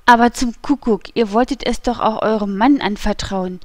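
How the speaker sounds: usual speaking voice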